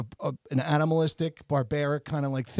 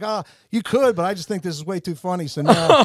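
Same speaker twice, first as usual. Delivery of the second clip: mock; 4 semitones higher, 9 dB louder than usual. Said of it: "another one will be like".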